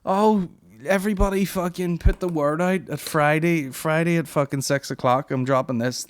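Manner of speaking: high, slowed influencer plug; hammed-up self-promotion; mock hype. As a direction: oversell the drop. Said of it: "You're going".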